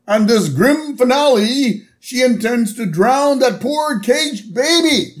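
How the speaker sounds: high, swooping theatrical declamation, slowing at the end; Sideshow Mel. Retold or imitated. Sideshow performer announcing the grim finale, high melodramatic oration. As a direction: proclaim it like a hammy tragedian. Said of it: "And Sideshow Mel".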